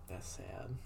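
soft, teary reaction; a quiet, choked-up remark; tearful. Real, not acted. Sad grave scene reaction.

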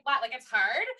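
lightly voiced Elle Woods; a hint of bubbly Elle Woods disbelief; a touch of the ditzy Elle Woods "what, like it's hard". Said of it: "a very Elle Woods moment".

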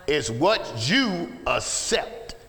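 slow, measured emphasis on each word; slow and deliberate. Real, not acted. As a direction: spell it out slowly for the congregation. Measured first take before shouted repeat.

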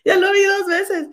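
crying with real laughter, high-pitched; laughing. Real, not acted. Host laughing to her comadre about the video, laughing helplessly. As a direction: squeak it out through tears of laughter. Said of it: "estaba yo risa y risa".

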